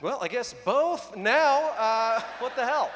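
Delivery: loud, high, slow exasperation; exasperated. Retold or imitated. Comic answering the casting director's question, loud exasperated disbelief. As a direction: throw up your hands in disbelief. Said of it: "I was like".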